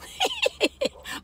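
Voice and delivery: high squeaky laugh; high-pitched giggle. Real, not acted. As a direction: giggle at your own joke. Laughs after song line.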